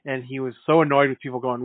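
normal talking voice

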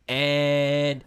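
drawn-out mock play-by-play; Joe Buck. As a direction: imitate an announcer holding the suspense. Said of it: "he does say that".

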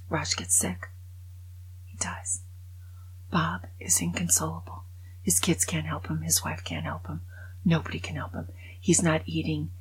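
hushed grave whisper; hushed storytelling. Real, not acted. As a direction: drop to a whisper for the sad part. Sad part of the joke hushed.

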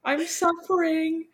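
Louis moaning about his suffering in the sewer, high and slow; melodramatic Louis. Retold or imitated. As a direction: wail like a tortured poet. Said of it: "And Louis is like".

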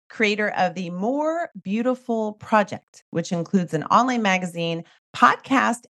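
ordinary talk, nothing marked

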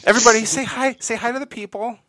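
loud, high, cheery call; rally the listeners; hype host. Acted. Hyping audience to say hi.

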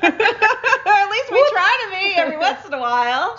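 high giggling voice; laughing. Real, not acted. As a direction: laugh through the words. Laughing together at a joke.

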